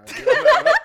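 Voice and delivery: loud, high burst of near-tears laughter; overcome, squealing laugh; tearful laugh. Real, not acted. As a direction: burst out laughing, close to crying. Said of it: "I'm gonna cry right now".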